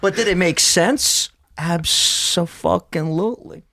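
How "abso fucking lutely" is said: slow stretched abso-fucking-lutely; drawn out. Real, not acted. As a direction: drag it out in triumph. Triumphant self-justification.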